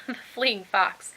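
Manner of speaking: ordinary delivery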